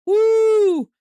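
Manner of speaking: high, loud celebratory whoop; joyful whoop. Real, not acted. Fan cheering the run scoring with a loud whoop. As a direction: let out a triumphant yell. Run scores for his team.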